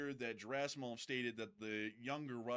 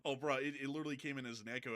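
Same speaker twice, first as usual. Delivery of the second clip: normal talking voice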